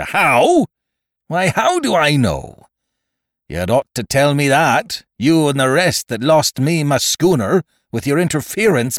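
high, indignant scolding that settles at the end; pirate captain flaring up in outrage; angry pirate tirade. Acted. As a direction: dress down an insolent shipmate. Silver rages at George in warning.

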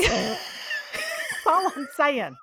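laughing through the words; words spilling out mid-laugh. Real, not acted. Laughing at her own point.

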